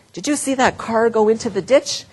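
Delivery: anxiously pointing out the crash; alarmed passenger. Pretend. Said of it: "level two".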